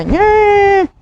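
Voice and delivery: high, drawn-out fake bawl; mock crying. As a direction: imitate a hired mourner's exaggerated wail. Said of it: "estaban llorando primero por la niña".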